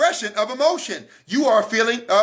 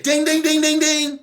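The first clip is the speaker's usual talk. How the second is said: bell ding; 7 semitones higher than usual. Acted. Alarm bell marking the insight.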